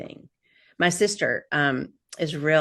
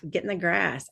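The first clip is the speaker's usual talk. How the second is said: usual speaking voice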